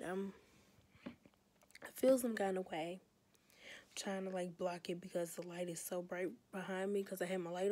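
soft, half-whispered so as not to wake anyone; tired hush with breathy whispers; hushed late-night voice. Real, not acted. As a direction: keep it down, someone's sleeping. Cousin going to sleep nearby.

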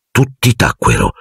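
ordinary delivery, nothing marked

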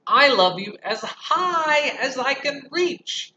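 high, loud, slow and reaching; eager little bunny; little hare. Acted. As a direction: stretch up proudly.

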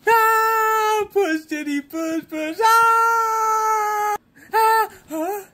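very high, loud birthing wails; acted labour screams. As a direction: scream and push through a pretend birth. Acted childbirth at the hospital.

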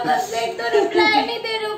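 tearful outburst, voice breaking; crying. Real, not acted. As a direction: cry it out in protest. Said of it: "सच में रोने लगी यार तू".

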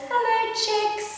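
high, bright puppy greeting; little dog's greeting. Acted. Mum voicing the little dog for a toddler, high and eager. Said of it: "the little dog says".

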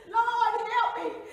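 very loud, desperate plea; screamed prayer. Acted. Woman struck with a billy stick, screaming to the Lord. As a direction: scream for God's help. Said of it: "And I screamed".

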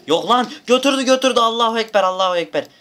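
high, loud frantic yelling; panicked shout. Real, not acted. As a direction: scream as the fight turns bad. Panicking as character gets killed. (yüksek sesle panik içinde bağırış)

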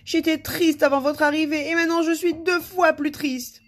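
high, loud, hammed-up wail; sobbing. A narrator's reading. King laments to his visitor, mock sobbing. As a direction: wail in theatrical misery. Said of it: "il se mit à sangloter".

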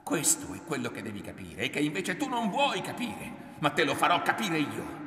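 high, loud, heated argument; angry insistence. A narrator's reading. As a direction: drive the point home at her. Son keeps arguing angrily with mother.

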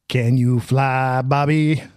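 slow, deliberate delivery; drawn-out movie quote. Retold or imitated. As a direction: stretch each word of the taunt. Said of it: "It's just a great delivery".

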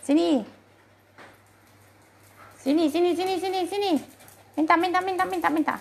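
high, insistent, rapid-fire calls; pet calling. Real, not acted. (panggilan hewan bernada tinggi, berulang)

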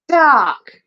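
high, loud stage cry; over-eager amateur actor exclamation; exclaiming. A narrator's reading. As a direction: proclaim the word you were just fed. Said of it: "Elvis exclaimed".

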